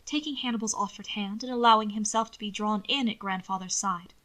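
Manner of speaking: ordinary delivery, nothing marked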